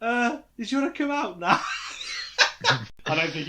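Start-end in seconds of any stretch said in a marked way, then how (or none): 0.0-1.6 higher, lightly acted call through a door; mock coaxing parent. Asking a kid to come out after an argument, mock coaxing. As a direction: coax a sulking kid out.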